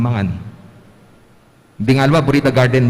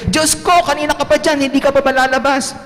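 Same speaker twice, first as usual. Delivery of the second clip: very high, fast mock angry mother; kid mimicking mom; 13 semitones higher than usual. [name] imitating his mother banging on the bathroom door.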